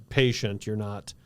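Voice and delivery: ordinary talk, nothing marked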